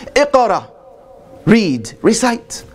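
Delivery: high, slow command, each word spaced; commanding angel voice. Acted. Angel commanding the Prophet to read, slow high command. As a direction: call out the command, drawn out and weighty.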